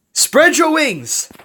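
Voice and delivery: high, loud, drawn-out command; commanding high-pitched call; shouted order. A narrator's reading. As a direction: call the order out over distance. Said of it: "Plucky ordered".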